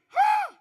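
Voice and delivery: high, loud hype huh. Acted. Speaker hyping the audience with a loud huh. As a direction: bark it at the crowd.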